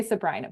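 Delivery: Sabrina with the middle vowel as in "eye", suh-BRY-nuh; local pronunciation. Retold or imitated. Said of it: "They all say Sabrina".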